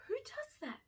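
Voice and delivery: high incredulous question; amazed. Real, not acted.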